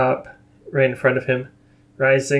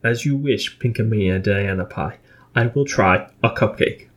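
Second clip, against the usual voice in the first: lightly voiced Teal'c; 2 semitones lower than usual. A narrator's reading.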